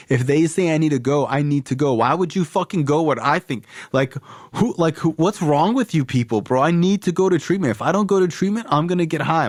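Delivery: high, desperate, pleading rant; high-pitched pleading; frantic ranting. Retold or imitated. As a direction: beg your mom like you're about to crack.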